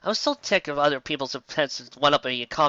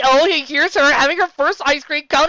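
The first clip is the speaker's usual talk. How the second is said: gushing parent; 12 semitones higher, 7 dB louder than usual. Acted. Proud mom showing baby pictures, gushing coo.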